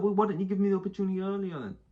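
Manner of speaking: high, loud outraged complaint; indignant protest. Acted. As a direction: protest loudly, pitched right up. Voices the driver's indignant protest.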